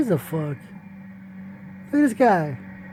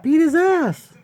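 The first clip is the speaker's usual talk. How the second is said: egging on; 9 semitones higher, 3 dB louder, slower than usual. Real, not acted. Cheering on the fighter.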